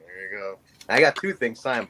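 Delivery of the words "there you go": much softer, lower, bored "There you go"; dismissive mumble. Retold or imitated. Fan mimicking Flair's bored mumble at signing. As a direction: toss it off without looking up.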